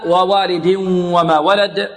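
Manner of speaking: ووالد وما chanted with a sustained nasal merge; idgham with ghunnah. Shown as a demonstration. Teacher shows students the rule, merging with ghunnah. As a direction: hold a nasal hum joining ووالد to وما. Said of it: "غنة، إدغام بغنة".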